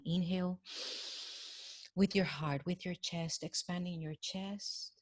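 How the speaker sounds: soft meditation voice with long pauses; guided breathing meditation. Acted. Leading audience to breathe from the heart, hushed meditation. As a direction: guide them gently through each breath, fading on the exhale.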